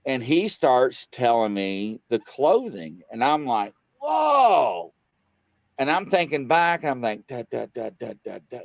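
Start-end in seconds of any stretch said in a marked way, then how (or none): 4.0-4.9 high, drawn-out whoa falling away; retold amazement. Guest retells hearing him describe the clothing, amazed. 7.3-8.6 quiet, muttered run of da-das; thinking back. Retold or imitated. Guest retells thinking back over past shows, muttering to self.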